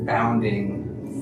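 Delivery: usual speaking voice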